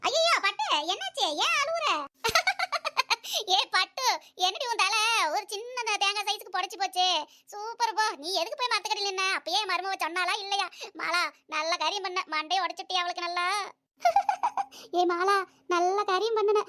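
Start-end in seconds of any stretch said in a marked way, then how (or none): 0.0-1.9 higher, jumpy cry of concern; mother-in-law pretending shock at the neighbour's injury; mock alarm. Finds the aunt crying. 1.9-3.5 high cackle in a different voice; mother-in-law mocking the aunt's swollen head; gleeful laugh. Laughs at the hurt aunt. 14.0-14.9 shrieking mocking laugh; mother-in-law delighted the meddler got hurt; high gleeful cackle. Laughs at the aunt.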